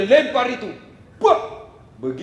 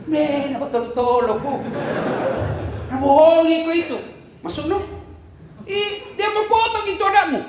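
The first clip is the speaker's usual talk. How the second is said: alarmed devil; 10 semitones higher than usual. Acted. Iblis alarms Hajar.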